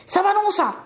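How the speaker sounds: high, ringing incantation; shouted spell. A narrator's reading. Girl's eyes flash as she defends herself, high spell cry. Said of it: "und sie schrie".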